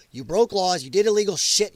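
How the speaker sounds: ordinary delivery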